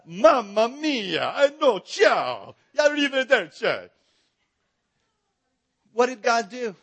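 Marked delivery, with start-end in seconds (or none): 0.1-3.9 slow, hammed-up Italian accent; a slow, over-the-top mock Italian phrase. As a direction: drawl it like a cartoon Italian. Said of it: "it's something like".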